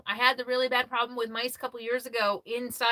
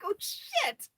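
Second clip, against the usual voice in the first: high, hushed startled oh shit; shocked oh shit; 4 dB quieter, much slower than usual. Retold or imitated. Seeing the red shirt, a high startled oh shit. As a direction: gasp it out like you just realised. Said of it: "I was like".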